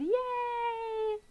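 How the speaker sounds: high little cheer of joy; excited squeal. Real, not acted. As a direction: squeal a happy yay. Cheering a viewer's good weekend.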